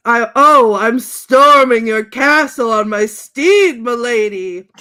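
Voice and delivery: very loud, slow, stretched declaration; mock heroic cry. Fan quoting Ron wooing his lady, loudly heroic. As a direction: bellow it like a storming knight. Acted romantic movie outburst.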